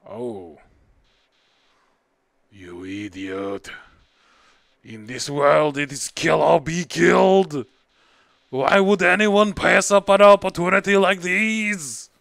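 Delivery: villainous flower snarling at the player; menacing flower. Acted. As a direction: drop the mask and threaten.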